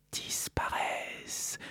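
breathy whispered word, slow and drawn out. Acted. Host whispering the last word of his point, slow and soft.

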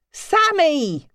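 high, loud call up the stairs; mother calling her daughter from below; calling upstairs. Acted. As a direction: call her name up to the bedroom.

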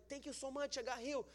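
high, quick thanks of someone just prayed for; mock grateful. Healed person thanking the one who prayed, mock grateful.

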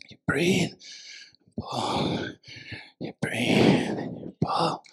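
very soft and low, fading to whisper; hushed, meditative preaching. Real, not acted. Preacher closing on breathing through life's storms, hushed to a whisper. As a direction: sink from a murmur into slow whispered phrases.

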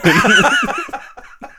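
high, loud burst of laughter; cackling at her own bad joke; laughing, embarrassed at her own line. Real, not acted.

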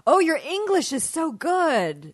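high, very slow patronizing praise; patronizing stranger. Acted. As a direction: praise them as if to a child, drawn out. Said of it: "playing the different people saying the stupid things".

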